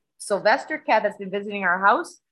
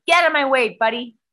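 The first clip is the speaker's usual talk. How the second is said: louder, higher scolding yell at the cat; retold yell; 7 semitones higher, 5 dB louder than usual. Owner shooing her cat out of the way, retold yell.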